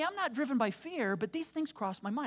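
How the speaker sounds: ordinary talk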